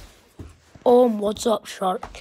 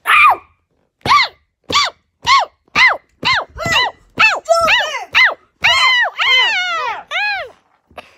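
very high repeated yelps; hammed-up high shrieks of pain; pretend pain cries; 4 dB louder than usual. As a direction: squeal each ow as it gets hit. Toy repeatedly hit.

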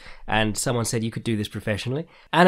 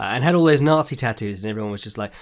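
ordinary delivery

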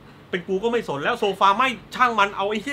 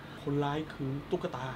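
hushed revelation; 4 semitones lower, 13 dB quieter than usual. Retold or imitated. Policeman telling a colleague the culprit, hushed. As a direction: confide it gravely under the breath. Norris quietly tells disbelieving colleague.

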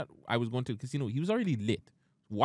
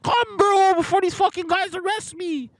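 high, loud panicked yelling over the phone; frantic drunk buddy shouting; friend yelling on phone; 9 dB louder than usual. Retold or imitated. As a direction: play the angry friend shouting down the line.

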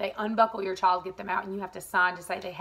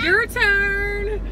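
excited; 9 semitones higher, 6 dB louder than usual. Real, not acted. Cheerful send-off to child.

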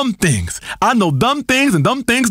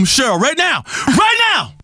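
ordinary delivery

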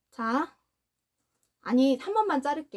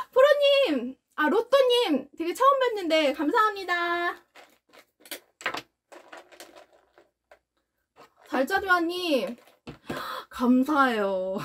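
choked-up grateful thanks; tearful thank-you to viewers; teary thanks; 4 semitones higher than usual. Real, not acted. Thanking donors, voice breaking.

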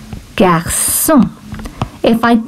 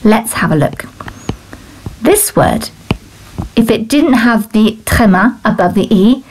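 ordinary delivery

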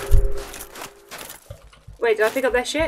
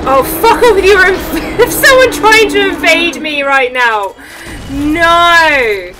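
loud high exasperated shout; annoyed yell; 6 semitones higher, 7 dB louder, slower than usual. Real, not acted. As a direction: yell in frustration. Invaded mid-fight, yelling.

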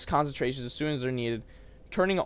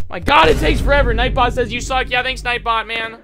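rattled; 8 semitones higher, 9 dB louder than usual. Real, not acted. Rattled after scare.

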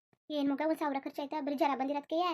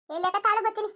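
calling out; 7 semitones higher, 9 dB louder than usual. Acted. Calling out to someone away.

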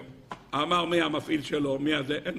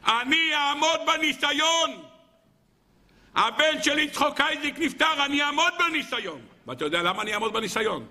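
fervent preaching; 7 semitones higher than usual. Acted. Rebbe vows to God to withstand the test, fervent. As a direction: declare it with fervor.

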